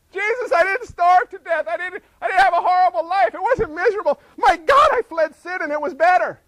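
overjoyed, telling Jesus it was all worth it; elated testimony. Acted. Believer arriving in heaven tells Jesus, elated near shout.

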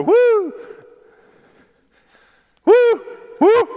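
high, loud calling whoops; farm calling whoop. Shown as a demonstration. Farm boy calling his cows, loud high whoops. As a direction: call the cows in from the field. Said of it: "that's the sound that we use".

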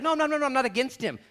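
high, loud mock denial; mock protest. Non-Christians protesting they aren't against Jesus, mock indignant. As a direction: wave it off, loudly indignant. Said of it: "They would be like".

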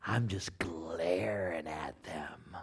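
breathy low hush; glaring whisper. Retold or imitated. Husband in ER waiting room, glaring whisper. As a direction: whisper it, eyes locked on them.

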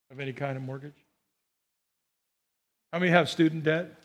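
ordinary delivery

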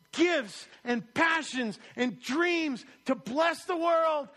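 high, loud rousing shout; preacher shouting blessing over Sarah Kim; fervent encouragement. Acted. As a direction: cheer a friend on with everything. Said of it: "We gotta say".

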